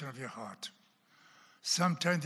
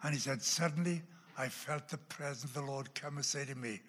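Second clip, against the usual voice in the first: ordinary delivery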